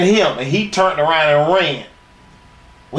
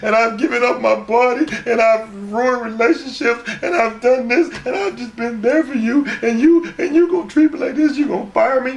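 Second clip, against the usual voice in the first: high-pitched mock whining plea; hammed-up tearful pleading; mock crying; 10 semitones higher than usual. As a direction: wail it out like a crybaby, mockingly. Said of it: "crying like a big old bitch".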